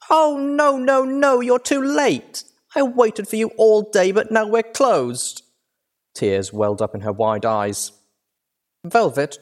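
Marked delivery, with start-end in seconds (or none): 0.1-5.4 put-on very high, loud childlike wail; dramatic filly dismay; horrified dismay. A narrator's reading. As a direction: wail it in over-the-top horror. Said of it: "as if gasping in horror".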